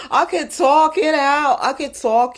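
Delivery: light put-on New York accent, playful. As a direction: lean into a casual New York twang for fun. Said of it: "let me stop doing my New York voice".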